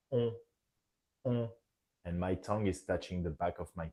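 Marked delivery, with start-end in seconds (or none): 0.1-1.5 rounded nasal "on" through the nose. Shown as a demonstration. Teacher repeating nasal "on" for students. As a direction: round lips, hum "on". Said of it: "It should be round when pronouncing this vowel".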